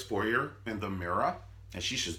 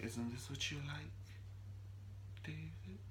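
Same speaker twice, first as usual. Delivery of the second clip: high, very soft, creepy coo; crazy scary voice; 6 semitones higher, 16 dB quieter than usual. Retold or imitated. Wife in blonde wig to husband, crazy scary voice. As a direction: coo it softly like an unhinged seductress. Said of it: "scary ass voice".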